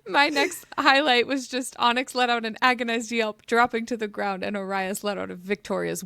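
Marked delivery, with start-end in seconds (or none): none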